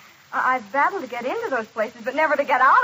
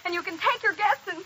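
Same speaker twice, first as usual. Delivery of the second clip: higher, indignant parting shot; defiant anger; 4 semitones higher, 4 dB louder than usual. Acted. Fired waitress quitting on the spot, defiantly. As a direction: storm out in a raised, angry voice. Quits after being fired.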